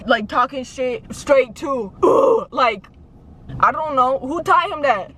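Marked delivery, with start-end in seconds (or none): 2.0-2.5 imitated grunt. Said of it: "changes his voice very quickly".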